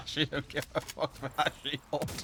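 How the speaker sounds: laughing through the words; amused chuckle while talking. Real, not acted. Laughing at enemy ignoring shield.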